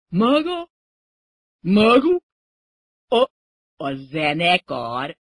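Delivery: high, loud yelps trailing into a slow question; stammered shock. A narrator's reading. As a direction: falter in horrified disbelief.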